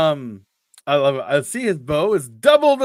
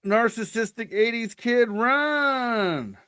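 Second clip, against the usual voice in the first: high, drawn-out playful call; mock urging; 6 semitones higher, much slower than usual. Co-host calling to the eighties kid in the story, mock urging. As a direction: cry it out like warning a film hero.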